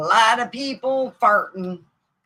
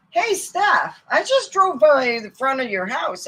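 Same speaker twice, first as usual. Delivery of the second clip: higher, lightly voiced boyfriend; boyfriend's voice; 7 semitones higher, 5 dB louder than usual. Boyfriend telling Steph about her house, lightly voiced. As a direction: quote the boyfriend, pitched up a little.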